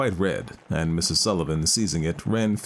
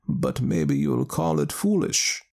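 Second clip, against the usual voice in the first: put-on high, slow wise old woman; a knowing village wise woman; 9 semitones higher, slower than usual. Ellen Leah adds.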